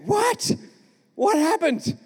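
high-pitched mock bewilderment of a boy; confused disbelief. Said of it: "I was just confused".

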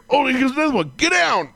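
high hammed-up yell of warning; mock panicked yell. Replaying the skit's punchline for a friend, mock panic. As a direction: bark it like diving for cover. Acting out the skit again.

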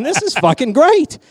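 high, loud, slow delight; thrilled. Retold or imitated. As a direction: gush like a fan. Retelling his own delight.

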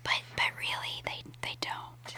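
hushed aside whisper. Real, not acted. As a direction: let them in on the secret.

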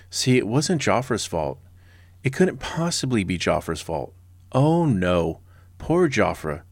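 mock sympathy, laid on thick; heavy sarcasm. Shown as a demonstration. Host mocking the blameless commander, thickly sarcastic. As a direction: feign pity for him, drawing out the start. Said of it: "I hope you can sense the sarcasm".

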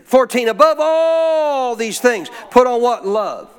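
impassioned preaching. Real, not acted. Preacher driving a verse home to the congregation, impassioned. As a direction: preach it with rising force, stretching key words.

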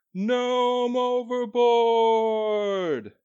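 long, high, drawn-out call; distant shout. Acted. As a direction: yell it across the deck. Someone shouts in storm.

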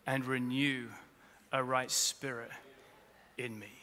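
prayerful scripture reading. Acted. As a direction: read it like a quiet prayer.

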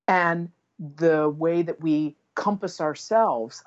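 normal talking voice

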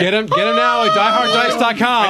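high mock ad read; ad announcer voice. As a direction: plug the sponsor like a commercial. Mock ad plug.

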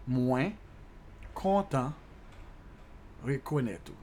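word by word, long pauses between "Mwen", "kontan" and "rekonet ou"; slow dictation style. Shown as a demonstration. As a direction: break it up for learners to repeat. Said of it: "let me slow that down a little bit".

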